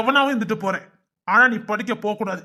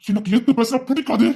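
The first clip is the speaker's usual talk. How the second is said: ordinary delivery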